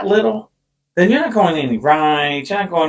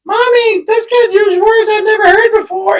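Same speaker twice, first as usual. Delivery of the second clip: imitated shocked kid. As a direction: play a scandalised child telling mommy.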